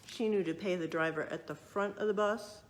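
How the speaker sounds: plain reading voice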